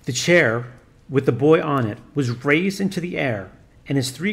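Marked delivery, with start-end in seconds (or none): none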